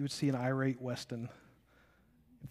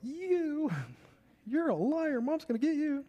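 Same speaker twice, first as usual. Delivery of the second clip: put-on high, childish tattling voice, much higher; a little boy yelling at his big brother, pitched way up; kid accusing brother; 13 semitones higher than usual.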